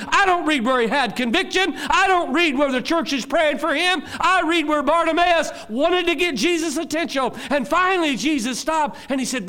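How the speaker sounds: loud, high, driving preacher; preaching at full force. Real, not acted. Preacher telling of the blind beggar, at full force.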